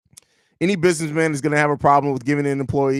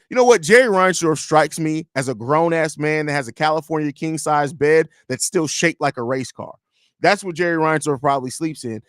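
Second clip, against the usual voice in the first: normal talking voice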